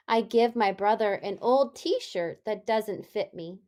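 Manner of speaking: a lightly voiced storybook read for little kids; reading to children. Teacher reading a story to her class, gentle storybook voice. Teacher reads picture book aloud.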